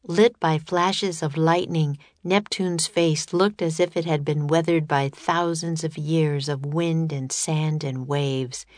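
plain reading voice

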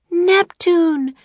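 high, loud cry at a sudden sight; Annie crying out. A narrator's reading. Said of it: "cried Annie".

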